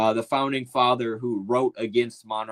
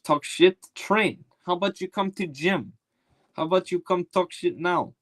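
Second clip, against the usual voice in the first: put-on Dagestani fighter accent. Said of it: "the Dagestanis are perfect".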